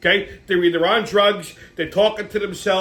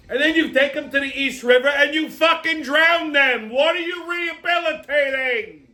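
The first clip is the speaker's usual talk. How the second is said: higher, rising outrage up to a yell; ranting shout; 7 semitones higher than usual. Real, not acted. Comic ranting at the city's homeless policy, building to a shout.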